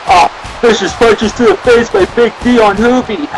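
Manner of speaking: live ringside play-by-play, rising to shouts; wrestling commentary. Pretend. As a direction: call the match like a wrestling announcer.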